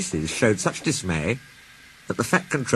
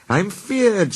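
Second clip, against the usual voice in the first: higher Scottish engine voice; lightly voiced worried engine; Donald's voice; 9 semitones higher than usual. A narrator's reading. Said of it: "said Donald".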